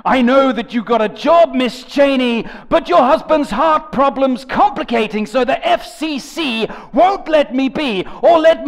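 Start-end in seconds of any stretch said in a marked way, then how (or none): none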